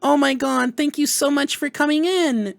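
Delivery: high, put-on girly voice; badly faked cutesy falsetto; uwu voice. Shown as a demonstration. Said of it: "Do that little ooh-woo shit".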